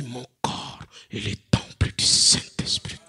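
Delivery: low, whispered solemn vow. Shown as a demonstration. Believer rising to resist temptation, solemn low whisper. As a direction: breathe it like a sacred oath. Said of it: "Tu te lèves solennellement".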